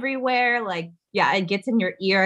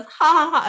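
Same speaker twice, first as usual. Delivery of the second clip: forced nervous ha ha ha, pitched up; mock nervous laugh; 8 semitones higher, 5 dB louder than usual. Luke laughing off the question, mock nervous laugh. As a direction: fake a nervous chuckle. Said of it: "he kind of, like, goes".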